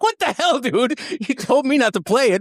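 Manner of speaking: high-pitched disbelieving outburst; exasperated. Retold or imitated. Retold calling friend at wedding.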